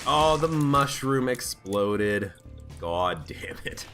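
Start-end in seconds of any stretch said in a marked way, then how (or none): none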